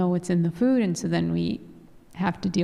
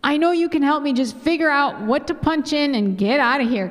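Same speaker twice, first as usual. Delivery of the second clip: urgent, higher retold prayer; praying plea; 6 semitones higher than usual. Wife praying for help with the pot, acted urgent plea. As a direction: plead in one pressing run, pitched up. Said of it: "praying, like".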